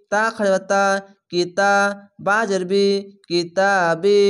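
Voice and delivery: usual speaking voice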